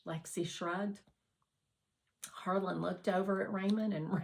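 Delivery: ordinary delivery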